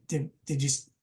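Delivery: ordinary delivery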